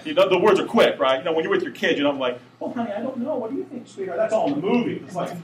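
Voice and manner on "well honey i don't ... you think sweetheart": high, softer mock-sweet voice; sweet talk. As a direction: coo to a sweetheart, gently. Contrast to dad's barked orders.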